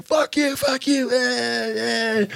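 high-pitched mock road-rage yell; imitated angry yelling. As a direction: jeer it in a high, cartoonish voice. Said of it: "still saying".

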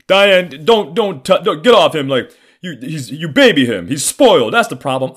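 loud, high-pitched scolding; yelling father. Retold or imitated. Father telling mom to stop babying the son, loud scolding. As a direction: imitate a father shouting his objections. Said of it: "this fucking jackass, he's just like".

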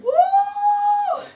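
sudden high falsetto woo; high whoop. Real, not acted. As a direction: whoop out with spirit. Preacher exclaims after emphasis.

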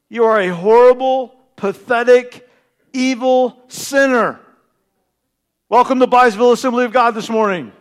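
loud, emphatic sermon call; full-force preaching; fiery preaching. Acted. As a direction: hammer the sin home.

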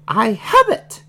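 high-pitched piggy character blurting out; sudden high falsetto outburst; sudden exclamation. A narrator's reading. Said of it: "Baby Piggy said suddenly".

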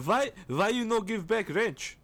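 hammed-up Serbian coworker demanding his wrench back; Serbian accent. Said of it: "That's a good accent".

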